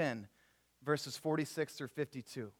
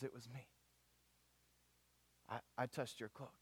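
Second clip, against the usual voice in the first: low, very soft, halting admission; timid confession; 4 semitones lower, 14 dB quieter than usual. Acted. Sick woman confessing to Jesus in the crowd, timid hush. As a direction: own up in a small hushed voice.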